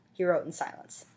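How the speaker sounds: ordinary delivery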